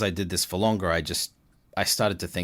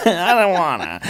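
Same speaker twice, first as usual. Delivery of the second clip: high, loud mock whine through a laugh; reluctant whine; 9 dB louder than usual. Actor replaying refusal of coach's push, laughing whine. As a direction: whine it like a laughing, unwilling kid.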